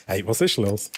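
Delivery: ordinary talk, nothing marked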